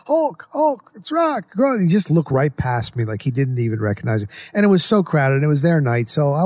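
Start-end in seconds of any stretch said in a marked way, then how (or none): 0.1-1.8 high calling out. Retold or imitated. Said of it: "I did like".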